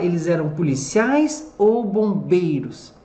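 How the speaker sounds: normal talking voice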